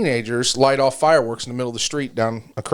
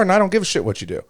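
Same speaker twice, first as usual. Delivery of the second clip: normal talking voice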